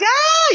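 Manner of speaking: very high imitated squeal; excited squeal. Teenage girls going up the ride, high mock squeal. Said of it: "estaban todas fascinadas".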